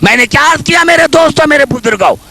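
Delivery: fast, loud and high preacher's call; loud preaching. Real, not acted. Preacher rouses the mourners, fast loud call. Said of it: "मैं जोर से बात कर रहा हूं".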